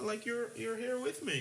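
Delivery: squeaky high mock voice; high falsetto voice. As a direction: pitch it way up, goofy and sweet. Voicing friend on holiday.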